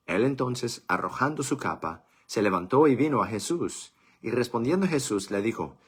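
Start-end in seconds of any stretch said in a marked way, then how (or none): none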